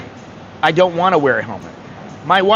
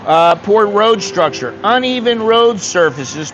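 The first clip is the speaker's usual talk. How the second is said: usual speaking voice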